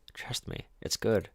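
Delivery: breathy whispered aside; mock whisper. Streamer mocking the host's lack of sources in a whisper. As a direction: whisper it conspiratorially.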